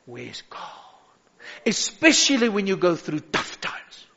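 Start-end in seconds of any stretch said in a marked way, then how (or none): 0.0-0.6 hushed, very soft demon's taunt; mock demon whisper. Demon whispering doubt into a believer's ear, hushed mock whisper. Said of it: "demons whispering in your ears and say".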